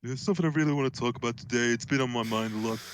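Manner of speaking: quick, droning streamer impression; Critical. Streamer imitates Critical opening a topic, in a quick monotone. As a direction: rattle it off, settling into a monotone. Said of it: "they have a monotone voice".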